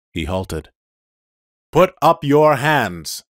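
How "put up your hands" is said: slow, loud shouted order; loud command. Acted. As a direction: order him to raise his hands. Police car orders him.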